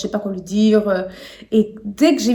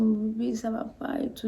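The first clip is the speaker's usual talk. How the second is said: soft whisper, near tears; crying to boyfriend, whispered; crying softly; 11 dB quieter than usual. Retold or imitated. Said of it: "j'ai commencé à pleurer".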